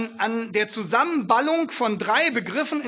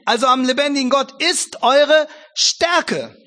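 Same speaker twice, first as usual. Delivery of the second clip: slow, loud, higher proclamation of the verse; solemn scripture reading; 5 semitones higher, 7 dB louder, slower than usual. Shown as a demonstration. Preacher proclaims Nehemiah to the congregation, slow and loud. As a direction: lean on every word like a pulpit. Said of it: "da heißt es".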